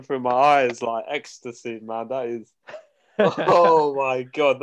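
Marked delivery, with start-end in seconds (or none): 3.2-4.6 very slow, stretched "Oh my God"; real awe, dragged out; drawn-out amazement. Real, not acted. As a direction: savour it in disbelief. Amazed at friend's comeback story.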